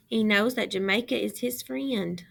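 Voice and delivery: usual speaking voice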